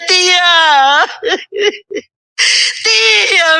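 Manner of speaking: a hint of a distant, crying young man calling out; nephew crying out. Nephew in torment calling to his aunt, crying. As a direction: call her name from far away, pained. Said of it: "alguien me grita".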